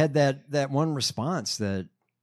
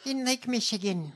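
high, hammed-up imitation; mock politician anecdote; 7 semitones higher than usual. Co-host parodies her constituent story, high mocking voice. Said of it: "You sound insane".

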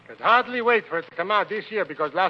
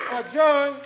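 higher-pitched summons; calling out; 8 semitones higher, 3 dB louder than usual. Acted. Boss calling the waitress over, calling out. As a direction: call across to a co-worker.

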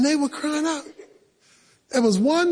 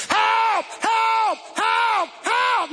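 high desperate shouts of help; crying for help; 9 semitones higher, much slower than usual. Retold or imitated. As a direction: yell for help like you're trapped. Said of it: "Some people were crying".

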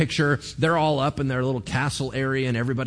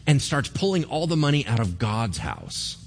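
normal talking voice

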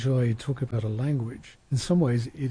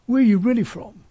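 pitched high and pushed louder, mimicking a nosy asker; mocking quoted question; 9 semitones higher, 6 dB louder than usual. Quoting a stranger's question to an immigrant, mock incredulous. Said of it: "as Shu said".

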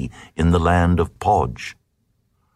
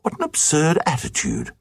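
high, quick, softer scoff; much higher, prissy scoff; sniffy queen; 7 semitones higher, 3 dB quieter, faster than usual. Acted. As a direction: toss it off like a scornful lady. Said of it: "And the queen said".

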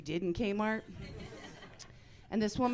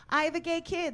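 high chirpy announcement; 10 semitones higher, 3 dB louder than usual. Retold or imitated. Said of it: "if I say to someone".

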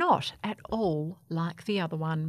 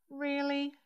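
soft, higher astonished question; gasped; 6 semitones higher, 5 dB quieter than usual. A narrator's reading. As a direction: ask in hushed disbelief. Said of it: "gasped Baby".

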